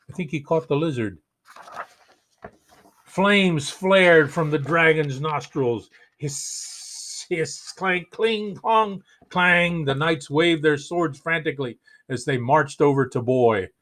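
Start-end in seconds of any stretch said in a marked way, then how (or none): 6.2-9.8 whispered hiss then clanging metal; hissing and clanking. Acted. Flames and armor noises.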